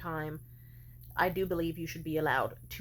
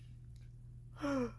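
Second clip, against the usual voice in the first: sharp gasp; 7 semitones higher, 8 dB quieter than usual. Real, not acted. Sudden gasp mid-rant.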